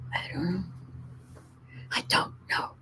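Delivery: breathy unvoiced whisper, trailing off. Real, not acted. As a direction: whisper the doubt to yourself. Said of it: "repeated, whispered".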